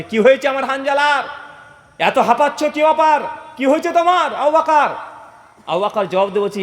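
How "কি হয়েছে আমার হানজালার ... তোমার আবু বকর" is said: high, urgent preacher's call; crying out questions. Acted. The Prophet questioning two breathless companions at his door, crying out. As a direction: exclaim in alarm, pitched up. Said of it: "চিৎকার দিয়ে বলছে".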